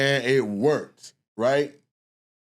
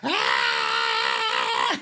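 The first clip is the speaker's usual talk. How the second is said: shriek; 10 semitones lower than usual. Retold or imitated. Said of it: "she's screaming and yelling".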